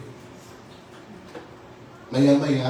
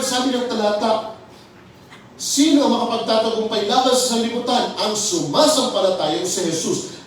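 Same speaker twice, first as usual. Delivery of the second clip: raised, ringing sermon voice, pressing each phrase; fervent preaching; 8 semitones higher, 5 dB louder than usual. Acted. Pastor rallying his congregation with fervent preaching. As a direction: preach it with full conviction to the flock.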